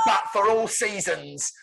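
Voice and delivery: high, loud showman's tagline; triumphant title announcement. Acted. Pitching the closing credits, triumphant title proclamation. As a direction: proclaim it as the grand finish.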